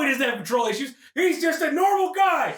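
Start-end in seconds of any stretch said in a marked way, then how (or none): 1.1-2.5 high, drawn-out comic protest; strained sarcastic defence, pitched high; mock-defensive insistence. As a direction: insist loudly and slowly, overdoing it.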